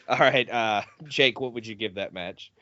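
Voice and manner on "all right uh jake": ordinary talk, nothing marked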